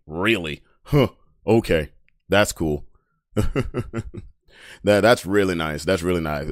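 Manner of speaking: deadpan, pretend to be impressed; dry sarcasm. Real, not acted. Sarcastic response to braggart.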